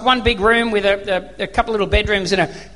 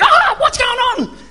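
high-pitched, drawn-out mock scream; panicked dad; 12 semitones higher, slower than usual. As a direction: shriek it like a panicking father. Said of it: "my dad screamed. He panicked".

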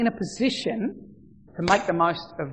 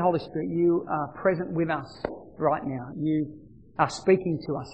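prayer; 3 semitones lower, 4 dB quieter than usual. Acted. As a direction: pray it gently for the church. Leads a prayer.

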